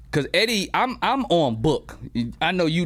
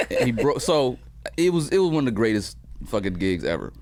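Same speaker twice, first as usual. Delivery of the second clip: ordinary talk, nothing marked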